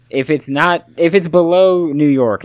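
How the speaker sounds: loud and high, arguing hard; emphatic outburst. Real, not acted. Arguing with his co-host about accents, loud and insistent. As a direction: push the point like it's obvious. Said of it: "arguing what counts as Southern".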